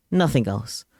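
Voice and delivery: ordinary talk, nothing marked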